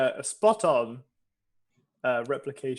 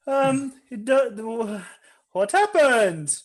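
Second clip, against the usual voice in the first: put-on high, loud, nervous German accent; 9 semitones higher, 6 dB louder, slower than usual. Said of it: "so that I don't lose the accent".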